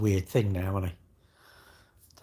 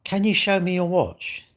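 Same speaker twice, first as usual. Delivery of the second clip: slow, higher mock request; mocking imitation; 7 semitones higher than usual. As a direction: parody the pestering stranger, drawn out. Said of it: "You come online and you say".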